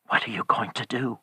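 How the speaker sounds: quick, anxious whisper; weak whisper. A narrator's reading. Husband holding his wife asks, weak whisper. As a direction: whisper it weakly and urgently. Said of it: "he whispered weakly".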